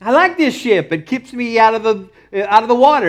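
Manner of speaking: high-pitched mock protest; Paul. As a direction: plead comically against bad news. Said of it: "you can imagine Paul going".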